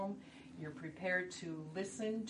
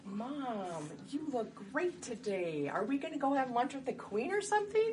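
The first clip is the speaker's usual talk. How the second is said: brightly flattering daughter, pitched higher; lightly acted daughter; 7 semitones higher, 5 dB louder than usual. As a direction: gush a little over Mom's looks. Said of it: "I'm the, um, daughter".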